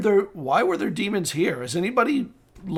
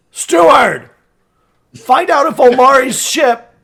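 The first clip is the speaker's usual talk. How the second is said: loud, higher call to a servant; shouted summons; 6 semitones higher, 8 dB louder than usual. Acted. Lord calling the steward in from outside, shouting. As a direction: bellow an order out the door.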